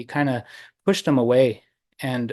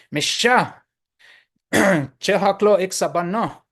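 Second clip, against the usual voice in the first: high, moderately raised scolding; acted angry yelling; 6 semitones higher, 4 dB louder, slower than usual. Angrier man driving off the woodpecker, acted yelling. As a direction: scold the bird in a raised, higher voice. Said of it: "he starts yelling".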